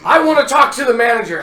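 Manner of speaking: high, loud, fast demanding whine; entitled customer impression. Customer complaining in mall scene.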